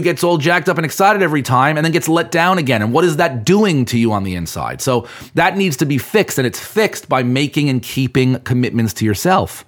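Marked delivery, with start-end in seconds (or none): none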